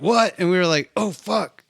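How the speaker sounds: high, loud disbelieving What; shocked reaction. Real, not acted. Friends shocked at an eight year jump, high and loud. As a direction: gasp it out in shock.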